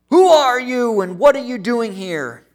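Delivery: loud challenge from a guard on the wall; guard yelling. Retold or imitated. Guard on fortress yells down at the stranger, loud demand. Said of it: "he yells down at this rabbi".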